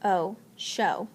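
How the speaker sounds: O held long, then show; teacher models the O sound, then the word. Shown as a demonstration. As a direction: stretch the O before blending show. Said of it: "so I would say sh".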